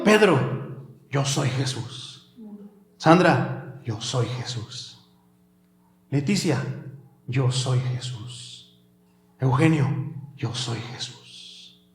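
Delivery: slow, hushed whisper of Jesus; soft whisper. Acted. Jesus speaking intimately to Jim, slow soft whisper. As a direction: breathe the words gently. Jesus addressing each listener by name.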